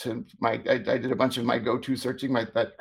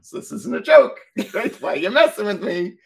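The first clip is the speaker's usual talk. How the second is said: incredulous; 8 semitones higher, 7 dB louder than usual. Retold or imitated. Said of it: "And I was like".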